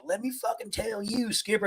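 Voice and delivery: high mock-angry officer voice; hammed-up officer lecture; mock officer tirade. Officer quoted bossing sailors.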